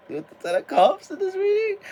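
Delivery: thrilled tarot reader squealing; excited squeal. Real, not acted. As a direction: burst out with delight over the card. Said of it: "I'm getting a little excited".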